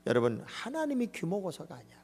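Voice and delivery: ordinary delivery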